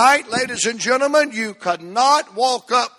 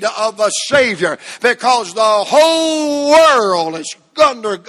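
rising, loud sermon cadence; preacher crying out to the congregation; fiery preaching; 3 dB louder than usual. Acted. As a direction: preach it to the rafters. Preaching climax.

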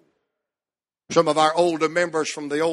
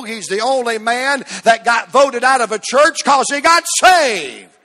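higher, driving revival storytelling; fervent preaching; 5 semitones higher, 4 dB louder than usual. Real, not acted. Preacher telling of a churchman's conversion, fervent preaching. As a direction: preach the tale hard to a loud peak.

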